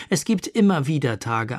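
ordinary delivery

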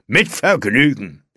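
loud, relishing reply; lautes Vergnügen. A narrator's reading. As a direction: announce it boldly, with relish. Robber gleefully wrecks the table. (laut und genüsslich)

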